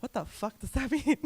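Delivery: retold confusion breaking into a laugh; laughing bafflement. Coach recalling first grant application, laughing in mock bafflement.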